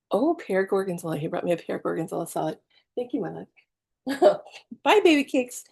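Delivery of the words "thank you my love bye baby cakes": tender thanks. Real, not acted. Thanking husband for salad.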